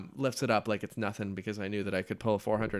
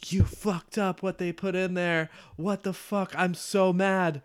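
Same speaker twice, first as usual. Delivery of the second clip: high, whiny mock outrage; mock angry whine; 8 semitones higher than usual. Lifter retelling blaming his helper, high mock whine. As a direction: pretend to blame her in a petulant high voice. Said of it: "And I was like".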